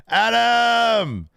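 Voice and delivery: called out high and loud off camera; retold shout. Recapping a wrestling promo, shouting a name off camera. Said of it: "off camera here".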